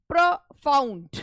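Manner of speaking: "Profound" slow and split, stress on found; stress on second syllable. Shown as a demonstration. Teacher demonstrating rising rhythm, stress on found in "profound". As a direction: break "profound" into two beats, weight on found. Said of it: "यहाँ पर आवाज़ उठ रही है".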